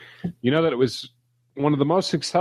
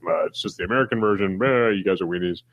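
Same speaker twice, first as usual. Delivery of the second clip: scoffing. Retold or imitated. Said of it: "scoffing at us".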